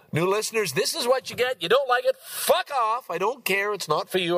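high-pitched defiant outburst; defiant rant. Real, not acted. Defiant rant at new listeners.

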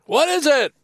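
high, loud called question; acted shout, pitch leaping up; shouting from afar. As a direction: holler it across a field. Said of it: "and shout from where I am".

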